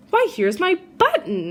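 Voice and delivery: a teddy bear delighted to find his button; excited cry. Acted. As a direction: cry out happily at finding it. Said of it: "he cried".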